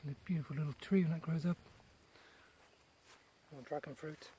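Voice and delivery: ordinary talk, nothing marked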